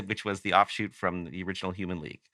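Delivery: ordinary talk, nothing marked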